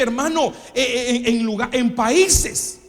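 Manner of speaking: ordinary talk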